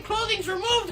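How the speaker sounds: usual speaking voice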